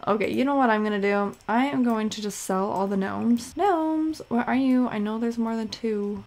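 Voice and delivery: ordinary talk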